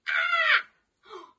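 loud high playful scream. Shown as a demonstration. Teacher showing children the crocodile scream, loud and high. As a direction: let out a big high scream. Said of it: "can you practice your scream with me".

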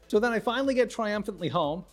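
normal talking voice

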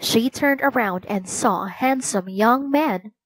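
ordinary delivery

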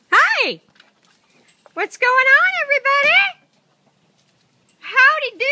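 loud, high, bubbly hello to the flock; greeting the chickens with a loud, high, happy call; excited greeting. Real, not acted. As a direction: sing out a big cheerful hi to the chickens. Cheerfully greeting her chickens.

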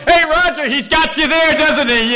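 lightly voiced gleeful teasing; amused officers. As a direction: rib him as a hooting onlooker. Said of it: "rolling over laughing".

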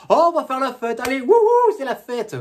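high, loud hammed-up party whoop; mock the friends' cheering. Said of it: "Ses potes sont là".

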